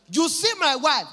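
high, loud sermon delivery; imitated preacher; preaching husband. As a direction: declaim it from the pulpit. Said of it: "husband dey preach in English".

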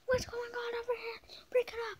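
high squeaky mock officer; high put-on voice breaking up a fight; high cop voice. New authority character arrives.

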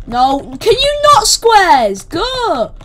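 high, loud protest; exasperated shout. Real, not acted. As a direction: yell at them to go away. Attacked by squares, protesting.

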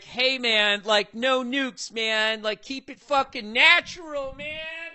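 lightly voiced hippie; stoned hippie. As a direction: hippies preaching no nukes, mock stoned voice. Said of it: "the hippies were like".

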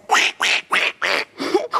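rapid unpitched quacks; duck quacking. Acted. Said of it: "A duck says".

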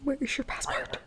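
whispered, repeating the question under his breath. Real, not acted. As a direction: mutter the question quietly to yourself.

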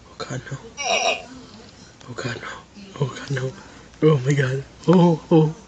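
usual speaking voice

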